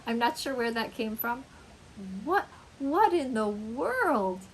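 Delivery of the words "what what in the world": show surprise. Shown as a demonstration. Teacher demonstrating a way to show surprise, amazed. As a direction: stammer the first word, then stretch out WORLD. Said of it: "it's a way to show surprise".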